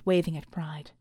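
ordinary delivery

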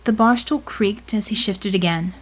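usual speaking voice

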